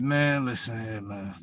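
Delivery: low, soft, fed-up aside; weary. Retold or imitated. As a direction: act out tired impatience, trailing off almost to nothing. Acting out weary reply to chatter.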